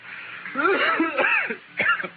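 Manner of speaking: long hacking cough; coughing fit. Acted. Sick man drinking with his friend, coughing fit. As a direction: cough hard, unable to stop.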